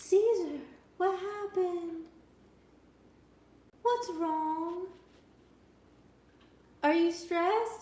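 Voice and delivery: high, slow coo at the dog; baby talk to dog. Shown as a demonstration. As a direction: coo to make the dog shake. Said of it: "I kinda wanna show you".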